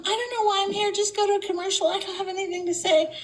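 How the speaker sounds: high-pitched, plaintive celebrity impression; Terry Garr. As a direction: play it high and helpless. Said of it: "Terry Garr".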